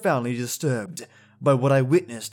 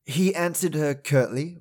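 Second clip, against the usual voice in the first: plain reading voice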